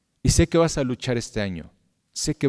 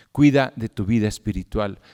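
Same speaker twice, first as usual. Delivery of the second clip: usual speaking voice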